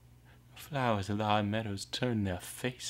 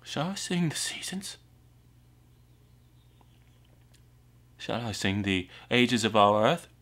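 raised voice building to loud proclamation; swelling declamation; 2 semitones higher, 10 dB louder than usual. Acted. Outcast asks to sing the ages, swelling declamation. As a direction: lift and swell each phrase into a ringing call. Bardic question swelling to full voice.

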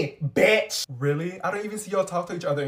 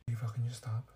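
whispering; 8 semitones lower, 14 dB quieter than usual. Acted. Embarrassed, hiding from girl staring.

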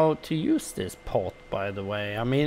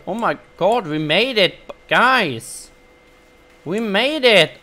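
relieved excitement; 6 semitones higher, 6 dB louder than usual. Real, not acted. Reaching the fort at night.

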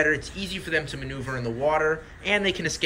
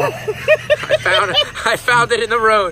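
laughing through the words, much higher; 7 semitones higher, 8 dB louder, slower than usual. Real, not acted. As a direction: giggle while showing off the joke. Presenting a joke toy.

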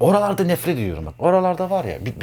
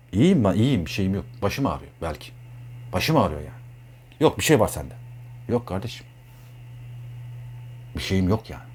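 sulky mumble; 5 semitones lower, 6 dB quieter than usual. Acted. A friend with a headache, brushing off the question softly. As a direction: lower and softer, brushing it off. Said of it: "acting a sulky friend".

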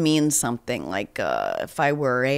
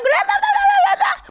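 much higher, faster, laughing nonsense babble; crazy person babble; 5 dB louder than usual. Retold or imitated. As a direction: rattle it off high and frantic like a madwoman. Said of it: "like a crazy person".

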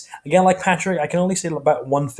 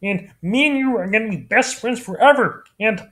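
Kermit the Frog; 9 semitones higher than usual. Shown as a demonstration. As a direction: coo a high love note to Miss Piggy. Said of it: "his impression of Kermit".

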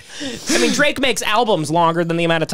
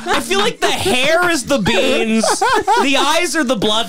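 laughing; 7 semitones higher, slower than usual. Real, not acted. Cracking up at own joke.

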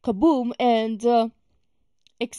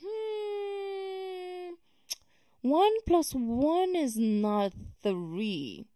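skeptical drawn-out hum, then slow, deliberate words; suspicious; 7 semitones higher, 5 dB quieter, much slower than usual. Acted. Always questioning what doesn't make sense, suspicious. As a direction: question it slowly, drawing out each word. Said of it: "I'm always sus. I'm always like".

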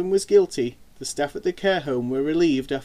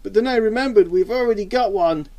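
higher, louder brotherly teasing; mocking; 7 semitones higher, 6 dB louder than usual. A narrator's reading. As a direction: poke fun at her in a raised voice. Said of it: "he laughed in an ignorant attempt to make fun".

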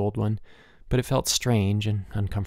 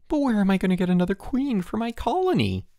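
wailing; 11 semitones higher than usual. A narrator's reading. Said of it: "he wailed".

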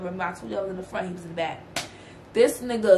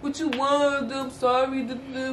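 higher, sweet-talking apology; mock begging with gifts; a light hint of a pleading boyfriend; imitating apologetic boyfriend; 3 semitones higher than usual.

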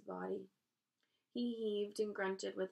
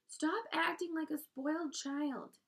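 high, raised-voice rebuke; screamed scolding; 8 semitones higher, 4 dB louder than usual. A narrator's reading. Wilbur scolding the lazy rat, screamed rebuke. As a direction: scold him at the top of your pitch. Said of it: "screamed Wilbur".

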